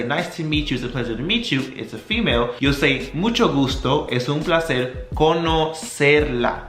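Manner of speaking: ordinary talk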